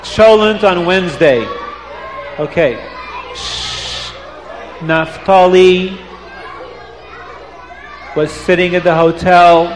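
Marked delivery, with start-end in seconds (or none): 3.3-4.1 whispered shh; shushing. Real, not acted. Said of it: "hushing the children".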